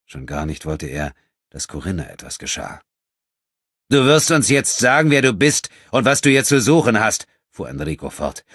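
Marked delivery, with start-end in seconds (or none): 3.9-7.3 harsh demand. Acted. Enrico interrogates the stranger.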